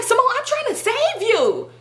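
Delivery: very high, hammed-up wail; acted friend's plea. Friend trying to save Simone, high mock plea.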